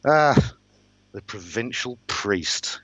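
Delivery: high, drawn-out aristocratic sneer; sneering lord. Acted. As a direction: look down on the priest, stretching the words. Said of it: "the look on his face was a sneer".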